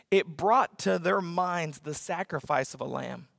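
ordinary talk

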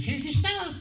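high-pitched mock girl's voice; squeaky, brief impersonation; imitated wardrobe girl. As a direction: chirp it as a put-on girl. Mimics the wardrobe girl handing over costume.

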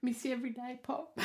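quietly breaking up; tearful. Real, not acted. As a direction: voice catching on a goodbye. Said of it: "I didn't think I'd get emotional".

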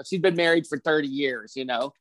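ordinary talk

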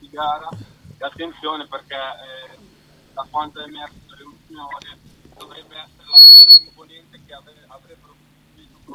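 faint, distant phone voice; speakerphone. Real, not acted. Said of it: "è a volume bassissimo lui". (in vivavoce, lontano e debole)

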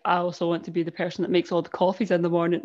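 imitating someone with no emotion whatsoever; low-key emotionless mimicry; deadpan. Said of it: "deadpan, no emotion whatsoever".

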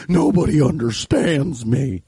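slow, put-on wobbly voice; weird customer voice. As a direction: lament in the odd customer voice.

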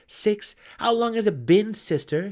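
high, loud, heated demand; angry pressing. Acted. Angry brother pressing his sister with a question. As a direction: press her hard, voice climbing. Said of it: "his anger growing".

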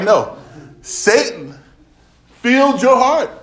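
loud, high-pitched mock correction; emphatic rebuttal. Preacher acting out a reply to David, loud emphatic rebuttal.

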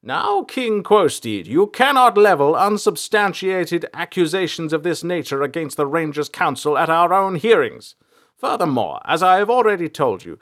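high, loud stern rebuke; forceful, high-pitched reprimand. A narrator's reading. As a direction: raise your voice to overrule an accuser. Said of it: "Samson raised his voice".

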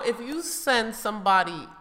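normal talking voice

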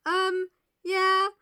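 high, loud mock regret; high-voiced comic paraphrase; Isabella's letter. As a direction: pipe it up high like a sheepish letter-writer. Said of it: "basically being like".

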